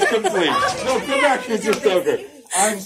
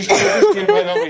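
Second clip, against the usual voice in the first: calling out; 12 semitones higher, 6 dB louder than usual. Real, not acted. Calling the kid back.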